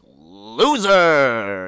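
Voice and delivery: loud recorded slot machine yell; machine announcer shout. Acted. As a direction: blare it like a jackpot machine.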